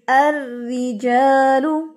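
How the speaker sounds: अर्रिजालु slow with a thin doubled र्र; tajweed recitation. Shown as a demonstration. Teacher recites an example for students, slow. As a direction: recite the Quran word drawn out. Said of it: "जैसे कुरान पाक में आता है".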